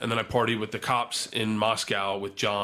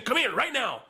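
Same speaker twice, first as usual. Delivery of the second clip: a cop barking come here, pitched way up; high-pitched cop call; 14 semitones higher than usual. Retold or imitated. Cop orders the guy over, sharp high call. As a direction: shout it up high like a cop summoning you.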